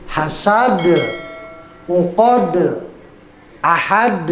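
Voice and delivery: "Hasad, uqad, ahad" each with a bounced d; qalqalah. Shown as a demonstration. Teacher showing dal examples, echoed d in "ahad". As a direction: release every final d in "Hasad, uqad, ahad" into a short uh. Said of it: "itu yang begitu, dal".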